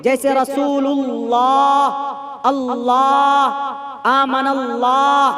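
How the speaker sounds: रसूलुल्लाह with a heavy, full-mouthed lam, slow. Shown as a demonstration. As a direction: fill the mouth on अल्लाह. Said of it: "जबर पेश हो तो पुर".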